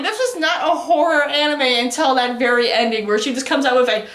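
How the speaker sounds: usual speaking voice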